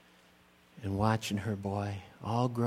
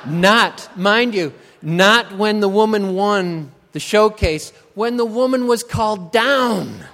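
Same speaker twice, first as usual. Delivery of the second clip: comic emphasis; 8 semitones higher, 13 dB louder than usual. Acted. Punchline about the crying mother.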